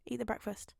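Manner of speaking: usual speaking voice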